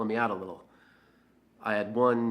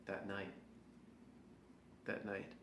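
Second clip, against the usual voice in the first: hushed brooding; 18 dB quieter than usual. Acted.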